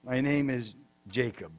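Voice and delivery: low, soft, downcast confession; head-down admission. Acted. Jacob confessing his name to God, head down and quiet. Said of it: "with his head down, I think".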